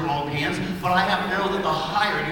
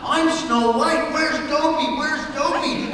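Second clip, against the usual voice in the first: demo scream; 9 semitones higher, 3 dB louder, much slower than usual. Shown as a demonstration. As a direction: call out slowly for lost Dopey, pitched high. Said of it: "scream in your loudest, loudest voice".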